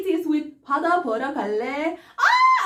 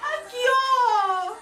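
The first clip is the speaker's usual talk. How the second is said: excited squeal; 7 semitones higher, faster than usual. Real, not acted. Fan squeals at idol video.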